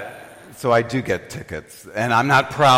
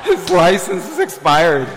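higher, louder mock announcement; hammed-up snitch calling it out; tattling friend; 6 semitones higher, 6 dB louder than usual. Friend tells on driver to cop, loud tattling.

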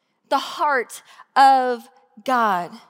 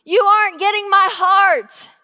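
higher, louder repeat; climbing, louder plea; pleading; 6 semitones higher, 6 dB louder than usual. Retold or imitated. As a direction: push it harder the second time. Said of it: "telling them very openly".

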